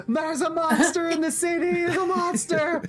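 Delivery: high panicked screaming; terrified scream. Acted. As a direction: shriek it as if fleeing a monster. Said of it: "She's just screaming".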